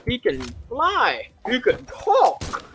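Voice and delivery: high, slow, drawn-out wonder; mock amazement. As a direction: marvel at it, stretching each phrase. Acted amazement at flying Donkey.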